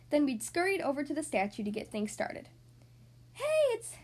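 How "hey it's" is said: put-on high, loud, delighted kid cheer; excited squeal of recognition; happy cheer. A narrator's reading. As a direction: cheer at spotting a friend. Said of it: "Kitty cheered happily".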